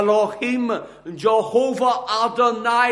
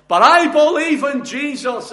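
high, raised-voice objection; protesting cry; 7 semitones higher, 5 dB louder than usual. Acted. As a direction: mimic a believer crying out in protest. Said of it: "is the cry".

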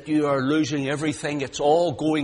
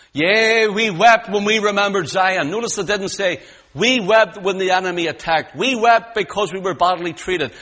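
loud, high, rising preacher's cry; full-force preaching; 8 semitones higher, 9 dB louder than usual. Acted. Preacher crying God's love to the congregation, full force. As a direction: preach it out to the whole hall.

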